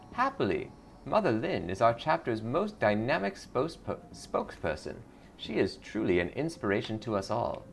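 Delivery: sugary cult greeter; lightly voiced sweet cult recruiter. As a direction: welcome her in like a smiling recruiter. Cult recruiter praises leader.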